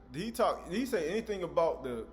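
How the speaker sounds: ordinary delivery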